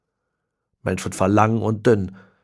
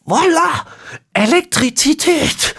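much higher, louder triumphant cry; cheerful announcement; 5 dB louder than usual. A narrator's reading. Ezekiel showing off his spark box, high and gleeful. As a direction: present it like a showman. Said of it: "verkündete er munter".

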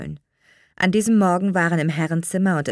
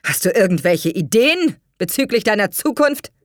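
mocking question, higher and louder; scornful; 5 semitones higher, 5 dB louder than usual. A narrator's reading. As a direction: needle him about his plans. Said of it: "fragte sein Vater höhnisch". (höhnisch, mit gehobener Stimme)

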